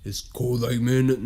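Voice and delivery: a hint of villain, drawn out; too-evil voice. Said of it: "too evil".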